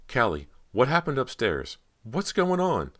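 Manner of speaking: high, loud, urgent questions; alarmed questioning. A narrator's reading. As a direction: demand answers in rising alarm. Frightened girl demands answers from ghost.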